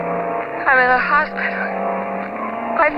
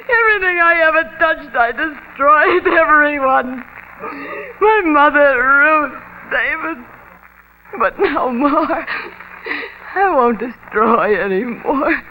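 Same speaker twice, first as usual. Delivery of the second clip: high, crying, voice breaking; sobbing confession; 4 semitones higher than usual. Acted. Joan confesses to David that she killed her mother, sobbing. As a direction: sob it out in despair.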